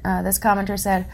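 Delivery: normal talking voice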